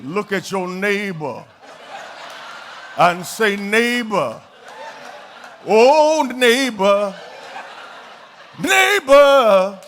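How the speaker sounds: low, slow, sing-song pulpit voice; parodying churchy preacher cadence; mock preacher tone. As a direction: drawl neighbor like an old-school preacher. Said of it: "they would talk different than they talk anywhere else".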